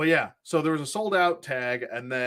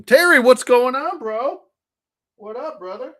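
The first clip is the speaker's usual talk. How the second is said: excited greeting; 12 semitones higher, 7 dB louder than usual. Real, not acted. Greeting a chat moderator.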